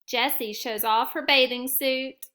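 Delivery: ordinary delivery, nothing marked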